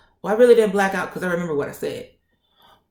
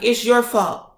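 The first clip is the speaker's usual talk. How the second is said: raised-pitch heated accusation; crunk, loud; 5 semitones higher, 3 dB louder than usual. Retold or imitated. Patient repeating the blame to the nurse, real crunk. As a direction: fling the blame, all worked up. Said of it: "I got real crunk".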